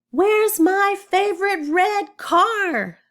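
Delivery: high, loud, drawn-out yell. Acted. Oscar to the house about his missing car, yelling. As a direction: yell it out slowly as an upset little boy. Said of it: "I'm gonna kinda yell right here".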